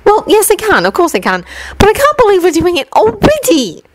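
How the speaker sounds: high, wry schoolgirl correcting herself; Hermione's voice. Acted. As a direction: pitch up into a clever girl's dry aside.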